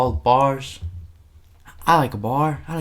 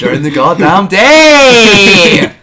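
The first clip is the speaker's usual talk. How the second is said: shouted, high and loud, mock fury; outraged shout; 9 semitones higher, 13 dB louder than usual. Real, not acted. Host shouting to co-host about siesta, outraged. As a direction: bellow it at the absurdity.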